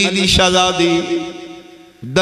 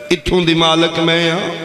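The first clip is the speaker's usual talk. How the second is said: ordinary talk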